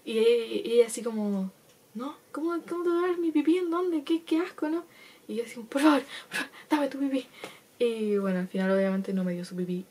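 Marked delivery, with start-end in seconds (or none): 1.9-4.8 much higher, appalled friend; disgusted friend. Retold or imitated. 5.7-7.2 higher, louder pleading; desperate begging. Retold or imitated. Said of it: "literalmente suplicando".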